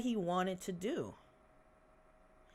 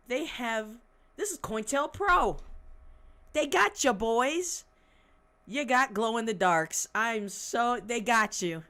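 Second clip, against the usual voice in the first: loud, higher gleeful taunting; 5 semitones higher, 6 dB louder than usual. Real, not acted. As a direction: crow over the catch. Gleeful rant about informants.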